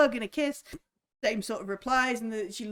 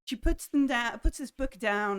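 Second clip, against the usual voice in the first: usual speaking voice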